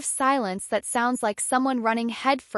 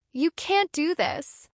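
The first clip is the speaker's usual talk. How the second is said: higher, slow, cracking voice; 5 semitones higher, slower than usual. A narrator's reading. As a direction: let the voice crack on it. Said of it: "Her voice cracked".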